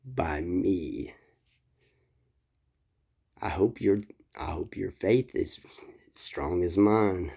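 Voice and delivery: whispered threat, low and slow; menacing whisper. Acted. Elijah taunting the prophets of Baal in a whisper. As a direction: hiss it softly like a veiled threat.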